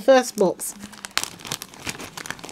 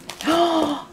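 high gasp of surprise; delighted gasp; 6 semitones higher, 3 dB quieter than usual. Real, not acted. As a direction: gasp at a lovely gift. Delighted surprise while unboxing a gift.

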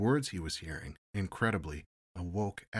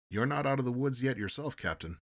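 wry; 6 semitones higher, 5 dB louder than usual. A narrator's reading. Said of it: "said Horowitz wryly".

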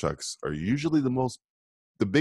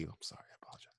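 whisper; 6 semitones lower, 23 dB quieter, much faster than usual. Acted. Mock contrite apology.

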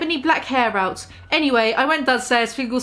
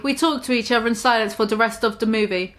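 plain reading voice

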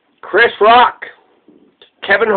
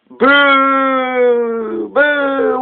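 mock booing; 3 semitones higher than usual. Booing the Electoral College.

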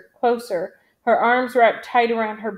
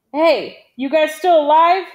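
loud, raised questioning call; 6 semitones higher, 7 dB louder than usual. A narrator's reading. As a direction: holler over to check on comrades. Calling out to squad after battle.

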